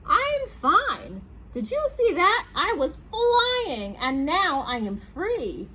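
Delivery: high, loud joyful hot dog voice; jubilant hot dog. Acted. Hot dog tells reader it is free, high jubilant voice. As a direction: crow happily, high at first, then drawn out.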